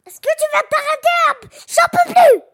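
high, loud, desperate wolf cry; wolf at the end of his rope; exasperated wolf outburst. Acted.

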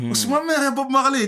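very high mock Marseille accent. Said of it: "j'entends les Marseillais parlant trop".

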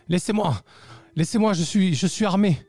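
put-on high, shaky old voice from afar; distant quavering voice. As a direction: call out trembling and threatening. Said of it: "une voix lointaine, un peu chevrotante".

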